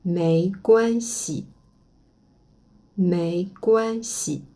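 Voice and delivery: slow mei guan xi, guan falling; mandarin pronunciation. Shown as a demonstration. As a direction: model mei guan xi slowly. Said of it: "Ça ne fait rien". (mei guan xi lentement, guan descendant)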